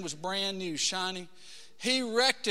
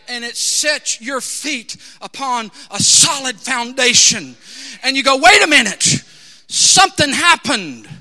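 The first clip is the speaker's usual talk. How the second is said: loud, emphatic preacher's testimony; full-force preaching; 4 semitones higher, 13 dB louder than usual. Acted. Preacher testifying to the congregation about being saved, loud and fervent.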